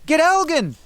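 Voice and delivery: high urgent shout. A narrator's reading. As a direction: yell it over the fight.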